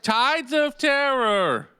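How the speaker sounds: dramatic announcer. Acted. Repeats dramatic title.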